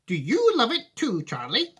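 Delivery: put-on a grave question from the old sweet-maker; serious Wonka. Wonka voiced in read story.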